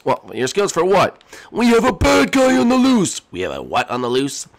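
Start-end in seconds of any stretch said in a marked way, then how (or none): 1.5-3.2 high, loud urgent warning; squeaky panicked warning; high alarmed character voice. Acted. Character warns of a bad guy.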